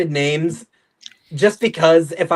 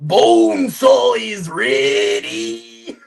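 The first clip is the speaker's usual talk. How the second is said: drawn-out, higher wrestler roar; wrestler shout; 4 semitones higher, much slower than usual. Retold or imitated. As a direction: imitate a wrestler's slow battle cry. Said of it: "the wrestler that Tobey Maguire faces just goes".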